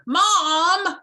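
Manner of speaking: high, loud whiny cry of Mom; whiny shout. Acted. Fish wailing for his mother, high and loud. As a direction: wail for her attention.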